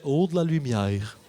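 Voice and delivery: dazzled moth. Retold or imitated. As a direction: drunk driver mimicking a moth drawn to blue lights, dazzled. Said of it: "je deviens un papillon et je fais".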